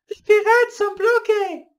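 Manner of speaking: very high, loud alarmed cry. Acted. Rachel crying out that pirates are trapped, loud. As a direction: shriek the news. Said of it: "Rachel crie".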